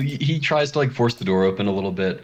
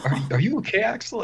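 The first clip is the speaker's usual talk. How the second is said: worried Rachel; 12 semitones higher than usual. Acted. GM voices Rachel.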